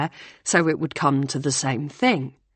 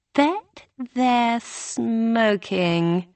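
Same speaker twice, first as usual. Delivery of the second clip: a touch wicked; 5 semitones higher, much slower than usual. A narrator's reading. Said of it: "with a slightly wicked look".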